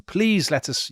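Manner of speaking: high-pitched, acted plea; desperate pleading. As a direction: retell it as an urgent beg. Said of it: "they're desperately arguing with them".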